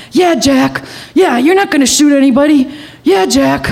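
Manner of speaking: high retold panicky echo; panicked. Panicked Sue behind her human shield, retold panicky echo. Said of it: "she's shaking and she's panicked".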